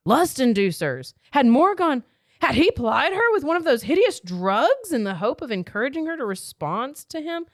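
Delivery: high, loud, scandalized narration; outraged realization. A narrator's reading. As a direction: voice her horrified suspicion at full pitch. Reads heroine's outraged suspicion.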